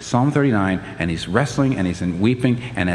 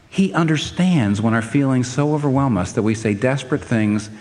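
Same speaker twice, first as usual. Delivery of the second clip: ordinary delivery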